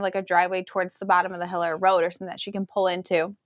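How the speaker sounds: normal talking voice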